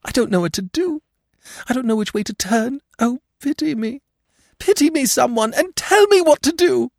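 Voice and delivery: very high, wailing woman's plea; acted hysterical despair; wildly distraught. A narrator's reading. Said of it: "sobbing wildly".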